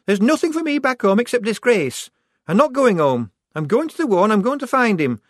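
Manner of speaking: high, loud defiant outburst; rounding on her. A narrator's reading. Shufti rounding on Polly who suggests going home, defiant. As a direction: turn on her and refuse to back down. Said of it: "rounding on her".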